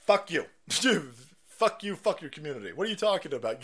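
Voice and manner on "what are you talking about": ordinary delivery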